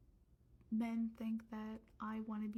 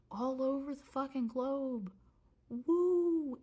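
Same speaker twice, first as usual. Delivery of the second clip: louder, higher, building into a whoop; worked-up outburst; 5 semitones higher, 7 dB louder, slower than usual. Real, not acted. Ranting to viewers about racism everywhere, worked-up outburst. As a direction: ride the rant up to a loud, high "Woo!".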